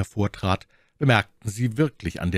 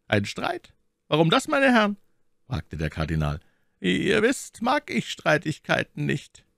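lightly voiced cardinal, smooth and higher; shrewd cardinal; 11 semitones higher than usual. A narrator's reading. Cardinal requesting escort, lightly voiced and smooth. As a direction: flatter and command at once.

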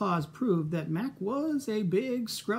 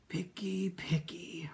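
whisper; 5 dB quieter, slower than usual. Acted. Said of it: "Herbert complained".

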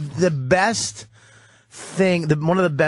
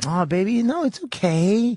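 consoling wife; 9 semitones higher than usual. Retold or imitated. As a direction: imitate a wife soothing him gently. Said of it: "to have her go".

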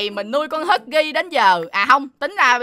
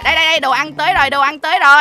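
quick, raised call to a hungry pet; hurried calling out; 2 semitones higher, 5 dB louder, faster than usual. Pretend. Owner hurrying food to the crying pet, hurried calling out. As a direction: call out fast as you bring food.

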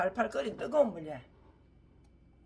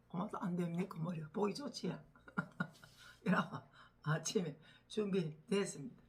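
ordinary talk, nothing marked

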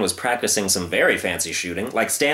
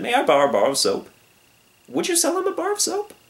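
polite lion voice. Acted.

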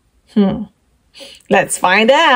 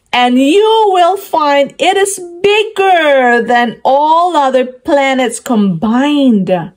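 ordinary delivery

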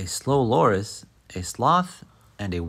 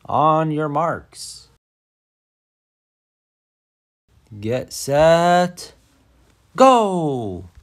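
higher, louder, slow drawn-out race start through a megaphone; megaphone start call; 6 semitones higher, 7 dB louder, much slower than usual. Acted. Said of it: "The mayor raises her megaphone".

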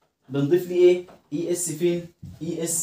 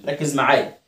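ordinary talk

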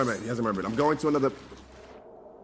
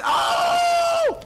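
very high shriek of alarm; a sudden high-pitched cry; shrill surprised shout; startled yelp. Real, not acted. Reacting to burning ship.

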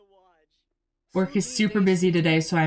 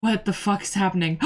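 ordinary delivery

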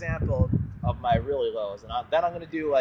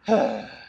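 sigh; 3 semitones lower, 3 dB louder than usual. Shown as a demonstration. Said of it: "take the sigh from earlier".

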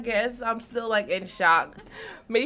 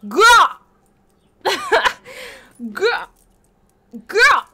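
hyped exclamation; 9 semitones higher, 7 dB louder, slower than usual. Real, not acted. Hyped reaction to the trailer.

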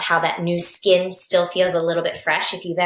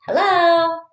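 high, louder peekaboo hello; playful hello; 11 semitones higher, 4 dB louder than usual. Acted. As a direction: pop out a cheeky high hello. Mantis turns head behind.

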